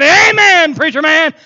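hearty amen from the pew; calling out amen. Retold or imitated. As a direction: holler support up to the preacher.

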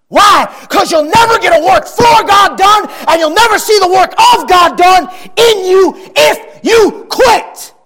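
high, loud fiery preaching; preaching shout. Acted. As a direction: shout the warning to the congregation. Preaching at full force.

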